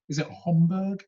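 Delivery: normal talking voice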